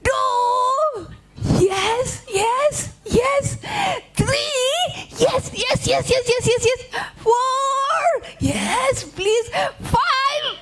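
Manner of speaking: very high squealed counting; frantic excitement. Acted. Rabbit counts as Anansi urges him on, frantic high squeals. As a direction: squeal it out in mounting anticipation.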